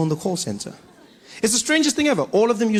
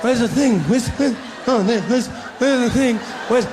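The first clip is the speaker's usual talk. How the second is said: frantic searching; 4 semitones higher than usual. Retold or imitated. Searching for foothold on fence.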